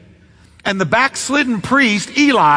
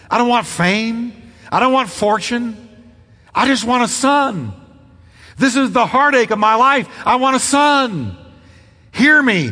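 desperate pleading prayer; 4 semitones higher than usual. Acted. Voicing Hannah's desperate prayer.

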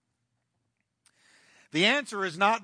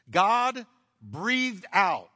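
slow emphasis; 2 semitones higher, 4 dB louder, much slower than usual. Acted. Preacher dwells on key phrase.

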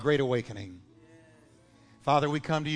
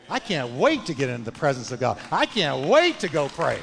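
louder, building eager call; fervent preacher's climax; rising preaching climax; 3 semitones higher, 5 dB louder than usual. Real, not acted. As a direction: build it up with joy.

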